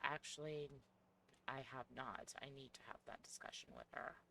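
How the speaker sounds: soft, hesitant alter voice; quiet shy persona. Acted. Plays Coco, a second persona.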